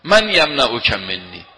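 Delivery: loud, slow, mock threatening cry; retold shout. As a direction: call it out as the swordsman, drawn out. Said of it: "یه مرتبه بلند فریاد زد".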